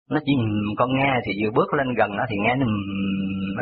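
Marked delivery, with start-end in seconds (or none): 2.7-3.6 low, drawn-out hum of a dog's growl; imitated dog growl. Storyteller imitating the dog as it was approached, muffled growl. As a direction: imitate a dog grumbling inside its mouth. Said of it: "ở trong miệng vậy thôi".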